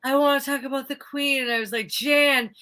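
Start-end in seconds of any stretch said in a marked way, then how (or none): 0.0-1.4 higher-pitched put-on coworker voice; imitated coworker. Imitating a coworker at work, higher put-on voice. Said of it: "she was just like". 1.9-2.5 higher, louder acted brush-off; mock exasperation. Retelling a brush-off to a coworker, mock exasperated.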